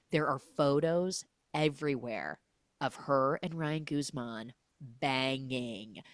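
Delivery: ordinary talk, nothing marked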